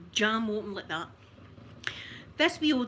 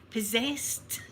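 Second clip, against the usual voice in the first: spooky whisper. Real, not acted. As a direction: breathe the word as a secret. Dramatic aside about being possessed.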